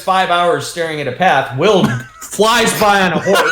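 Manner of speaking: high, laughing narration that keeps cracking up; laughing while narrating. Real, not acted. Game master cracking himself up as a rider flies past, laughing. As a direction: push the words out through real laughter. GM laughing at his own joke.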